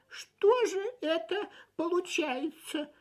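high, slow, whispered despair. A narrator's reading. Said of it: "убивался Фип".

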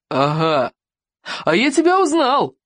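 drawn 'aha', then a high gleeful burst; triumphant exclamation. A narrator's reading. Chieftain recognising his soup-covered captive, triumphant exclamation. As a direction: leap up in pitch on the discovery. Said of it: "воскликнул он".

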